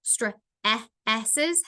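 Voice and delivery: stresses split into stre-, e-, esses; segmented phonics demo of stre-, e-, esses; sounded out. Shown as a demonstration. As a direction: isolate stre-, short e-, then esses with gaps. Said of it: "has the same vowel sound".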